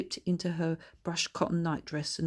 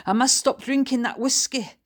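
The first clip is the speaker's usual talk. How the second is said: higher, louder Scottish auntie; chatty Scottish granny voice; Scottish old woman; 7 semitones higher, 8 dB louder than usual. A narrator's reading. Bertha thinking.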